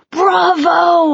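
narrated dad's yell of praise, held back a little. A narrator's reading. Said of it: "I heard Dad yelling through his hands".

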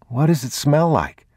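put-on high child's voice; curious little boy, pitched high; young boy. A narrator's reading. Boy asking his father about the rain, high childlike voice.

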